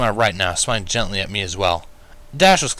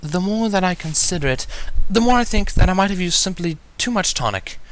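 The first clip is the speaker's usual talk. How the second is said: higher, refined and matter-of-fact; dry Rarity; 10 semitones higher than usual. A narrator's reading. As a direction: deduce it with cool elegance. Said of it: "Rarity deduced dryly".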